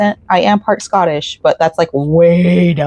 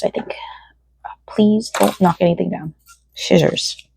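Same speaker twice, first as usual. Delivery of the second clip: soft hushed whisper; hushed aside to the cat; 5 dB quieter than usual. Real, not acted. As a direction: whisper so as not to startle. Whispering to pet while searching.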